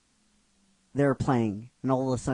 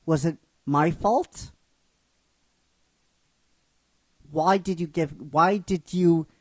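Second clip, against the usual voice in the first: rising distress, higher and louder as it builds; anguished inner monologue; 4 semitones higher, 4 dB louder than usual. Retold or imitated. Misty's inner monologue after hanging up, anguished and building. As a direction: pour out hurt questions to Ash, louder each time. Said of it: "she has inner monologue".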